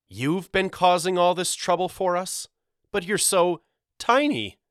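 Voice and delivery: high-pitched, mocking disbelief; incredulous taunt. A narrator's reading. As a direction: scoff at how small the foe is. Walter mocks the tiny goblin.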